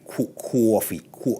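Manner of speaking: careful Boston-accented "coffee"; halting practice at a Boston accent. Shown as a demonstration. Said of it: "Trying to say the word in the correct Boston ac".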